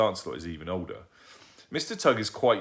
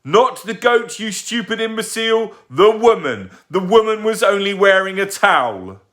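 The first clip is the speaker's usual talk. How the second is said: furious shout; 13 semitones higher, 14 dB louder than usual. Acted. Mister Tugg furious shouting.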